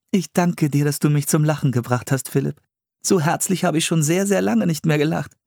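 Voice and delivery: high-pitched, friendly character voice; affectionate Priscilla, grateful. A narrator's reading. Priscilla thanks Philipp for making her laugh, affectionate.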